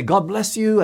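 a sing-song sarcastic God bless you; fake-sweet blessing, clearly not meant. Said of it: "sort of sarcastic tone".

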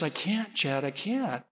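quiet, helpless no; soft refusal. Retold or imitated. Mother answering her pleading son, soft refusal. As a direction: refuse softly, voice falling away. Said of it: "And she says".

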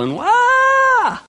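put-on high, held cry of a stung kid; retold kid's wail in fire ants; child's wail. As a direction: let out a long high wail.

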